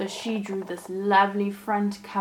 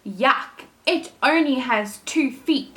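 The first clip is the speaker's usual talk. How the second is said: disgusted sister; 4 semitones higher, 6 dB louder than usual. Acted. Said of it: "said the sister".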